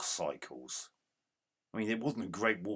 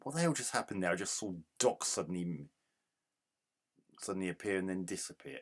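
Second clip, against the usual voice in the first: ordinary talk, nothing marked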